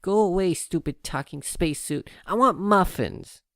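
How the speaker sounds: high, loud, slow whiny demand; filly shouting at the talking spacesuit; upset filly. Acted. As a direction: stamp your hoof at the suit. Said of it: "still upset".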